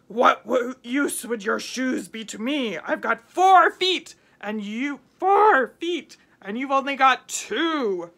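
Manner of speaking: loud, slow tiger boasting with a punched four. A narrator's reading. Storyteller voicing the tiger mocking the boy, loud. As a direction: growl out the tiger's scorn. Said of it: "But the tiger said".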